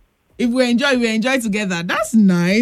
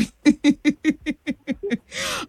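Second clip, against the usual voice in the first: real chuckling laughter; amused laugh; 3 semitones higher, 3 dB quieter than usual. Real, not acted. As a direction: laugh along with the caller. Host amused by caller's laugh.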